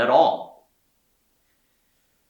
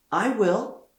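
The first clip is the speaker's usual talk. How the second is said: put-on high, eager shout of a boy; boy calls out keenly to volunteer; eager cry; 12 semitones higher than usual. A narrator's reading. Young Peter eagerly answers his mother, high eager cry. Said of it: "Peter cried".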